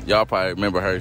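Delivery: ordinary delivery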